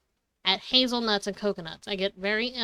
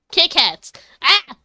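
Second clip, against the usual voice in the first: excited; 7 semitones higher, 7 dB louder, slower than usual. Real, not acted. Excited about favorite candy.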